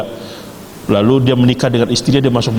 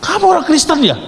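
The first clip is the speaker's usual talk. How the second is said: much higher startled question; shocked; 13 semitones higher than usual. Retold or imitated. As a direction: gasp it out high in shock. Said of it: "Kaget dia".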